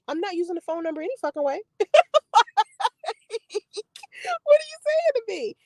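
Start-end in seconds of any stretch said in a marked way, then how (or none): 1.7-3.8 a high, crying-edged laugh burst. Real, not acted. Laughing in disbelief at the guy's texts, high laugh. 4.4-5.5 laughing disbelief. Real, not acted.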